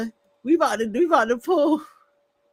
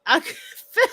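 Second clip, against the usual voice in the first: laughing through words; 3 semitones higher, much faster than usual. Real, not acted. Laughs mid sentence.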